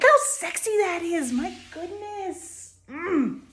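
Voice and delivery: high, slow, hushed swoon over wood. Real, not acted. Woodworker gushing over her walnut boards to viewers, swooning. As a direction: drool over the walnut like a crush. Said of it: "sorry to make you all uncomfortable".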